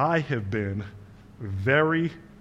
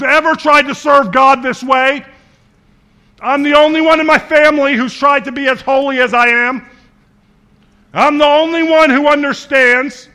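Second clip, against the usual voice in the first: loud, high, whining Elijah cry; self-pitying complaint; 13 semitones higher, 15 dB louder than usual. Acted. Elijah complains to God he's the only faithful one, loud and whiny. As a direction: wail it like a martyr who thinks he's alone.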